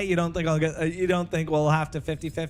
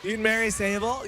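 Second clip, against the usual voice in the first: ordinary delivery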